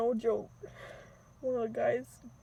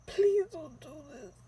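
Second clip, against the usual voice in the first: tearful plea; 5 semitones higher, slower than usual. Retold or imitated. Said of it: "I tell him".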